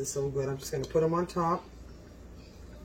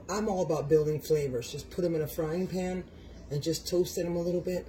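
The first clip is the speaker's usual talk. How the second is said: usual speaking voice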